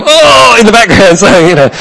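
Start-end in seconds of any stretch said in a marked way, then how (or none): 0.0-0.6 imitating men moaning on the ground, higher and louder; a hammed-up pained groan; mock groan. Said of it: "And I could hear them".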